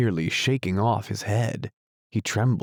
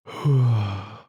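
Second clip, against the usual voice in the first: big sleepy sigh; weary sigh. A narrator's reading. Tired lion looking at Alice, heavy sigh. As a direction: let out a tired lion's sigh.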